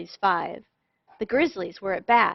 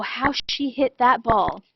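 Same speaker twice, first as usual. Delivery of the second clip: ordinary delivery, nothing marked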